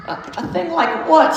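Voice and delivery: put-on very high, stammering girl voice; high childlike stammered question; little girl. A narrator's reading. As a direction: ask like a puzzled small child. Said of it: "said Lucy".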